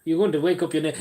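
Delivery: ordinary talk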